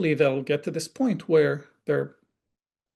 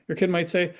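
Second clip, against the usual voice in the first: ordinary delivery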